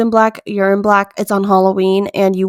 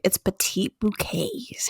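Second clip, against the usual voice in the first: whisper; 12 dB quieter, slower than usual. Real, not acted. Teasing reveal of favorite trend.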